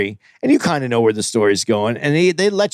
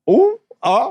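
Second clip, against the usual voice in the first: high-pitched mock "oh"; imitated reaction; 14 semitones higher than usual. Host retells co-host's museum reaction, high mock exclamations. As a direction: mimic his reaction in little high yelps. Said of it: "and Aries was like".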